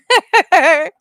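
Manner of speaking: loud high laugh; sudden loud laugh; burst of laughter. Real, not acted. Laughing at dog being mad.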